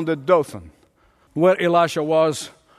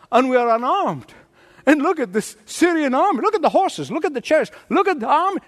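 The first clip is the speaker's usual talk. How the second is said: much higher, pleading and incredulous; can't believe what he's hearing, voice high and urgent; frantic disbelief; 7 semitones higher, faster than usual. Retold or imitated. Servant disbelieves Elisha, sees army.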